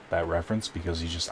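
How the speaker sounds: ordinary delivery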